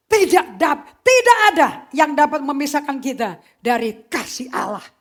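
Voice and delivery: loud, high, impassioned preaching; fervent pulpit preaching; full-force preaching. Acted. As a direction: preach it loud to the whole church.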